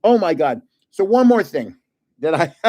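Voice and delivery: ordinary delivery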